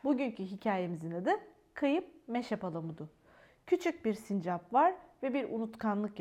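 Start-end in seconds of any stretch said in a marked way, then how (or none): none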